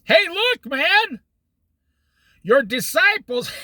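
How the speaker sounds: hammed-up nagging Karen yelling at Jesus. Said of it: "they yelled at him".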